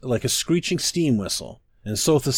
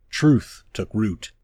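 ordinary delivery

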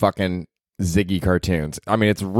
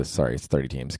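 ordinary delivery

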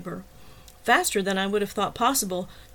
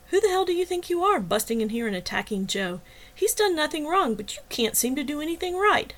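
angry; 7 semitones higher than usual. A narrator's reading. Heroine berating intruder.

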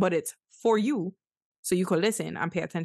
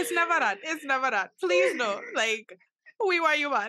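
excited please-no reassurance; eager reassurance; 9 semitones higher than usual. Real, not acted. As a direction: rush to reassure her, pitch up. Reassuring the guest.

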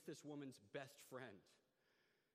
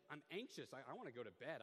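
ordinary talk

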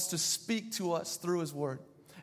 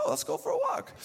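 excited owner; faster than usual. Retold or imitated. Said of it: "So I'd be like".

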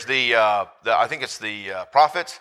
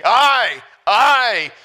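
loud joyful cry; 8 semitones higher, 6 dB louder than usual. Retold or imitated. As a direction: voice leaps high and loud on I, I. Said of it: "both of his hands raised in the air saying".

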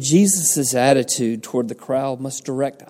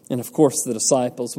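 ordinary delivery

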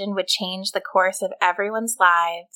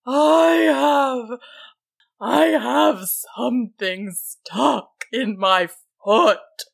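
troll calling out; 4 semitones higher, much slower than usual. A narrator's reading. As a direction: wail it slowly, stretching the words. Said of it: "called the troll".

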